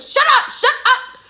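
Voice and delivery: high, slow, very loud command; retold yell. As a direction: shout down screaming family, retelling it. Retold yelling at screaming family.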